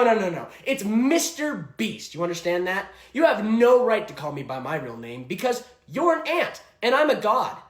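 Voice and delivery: barking tyrant boss. Acted. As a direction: bark orders like a tyrant. Mister Beast parody boss.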